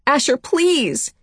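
high, drawn-out desperate plea; urgent appeal for help; pleading. A narrator's reading. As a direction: beg him slowly, pitched high.

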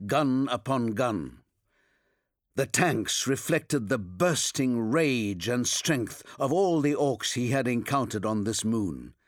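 usual speaking voice

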